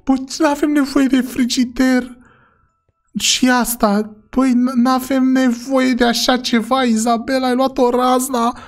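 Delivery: high-pitched whiny pleading; distressed protest. Acted. Shopper telling Izabela they need no fridge, whining in protest.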